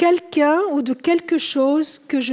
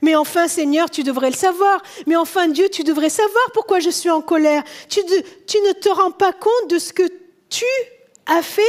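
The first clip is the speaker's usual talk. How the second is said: acted exasperation, pitched up; mock-aggrieved, higher-pitched complaint; indignant protest; 4 semitones higher, faster than usual. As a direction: protest as if the answer were obvious. Acts angry Cain protesting to God.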